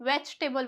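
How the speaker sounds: "वेजिटेबल" as vech-tebul, middle i dropped; correct pronunciation. Shown as a demonstration. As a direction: squeeze "वेजिटेबल" to three beats. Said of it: "क्या बोलते हैं".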